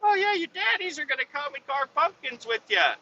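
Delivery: high sing-song put-on voice; mock schoolteacher voice; teacher talking to kids. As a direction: talk to little kids in a falsetto. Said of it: "they keep talking about it at school".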